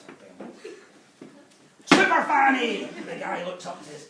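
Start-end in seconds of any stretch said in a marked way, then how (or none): 1.9-2.9 hammed-up loud slow showgirl call, pitched high; loud mock cry. Jane flashing the old man, hammed-up loud cry. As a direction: throw it out like a big reveal.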